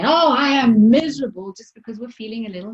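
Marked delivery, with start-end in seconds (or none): 0.0-1.5 loud, high, melodramatic moan; hammed-up misery; over the top. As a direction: exaggerate it like a drama queen. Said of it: "go way over the top and then say".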